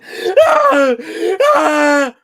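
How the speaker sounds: high loud imitated scream; mock horror scream. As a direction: mimic the horror-movie heroine scream. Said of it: "reducing them down to going".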